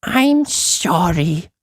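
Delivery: high, very slow small voice; tiny apologetic Uppity. Acted. Shrunken Uppity apologising to the boy, high and slow. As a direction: apologise humbly from ball size.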